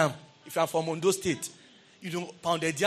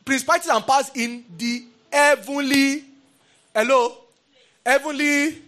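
fervent preaching; 6 semitones higher, 5 dB louder than usual. Real, not acted. Preacher pressing the congregation on principalities, full force. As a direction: pitch climbing, calling out to the congregation.

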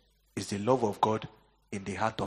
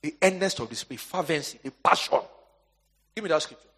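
ordinary talk

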